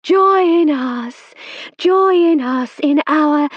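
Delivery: medium's trance summons; faraway voice. A narrator's reading. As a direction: draw the spirits in, slowly. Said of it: "in her faraway voice".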